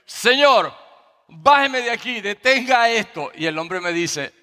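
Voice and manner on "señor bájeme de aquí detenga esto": high, pleading cry to be let off; panicked plea. Retold or imitated. Frightened dad begs ride operator to stop it, panicked plea. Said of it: "le digo al hombre".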